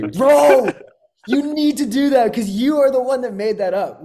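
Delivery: loud, high, hyped-up push; loud, high, hyped and insistent; excited insistence. Real, not acted. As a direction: fire up a friend about his idea. Hyping friend's idea.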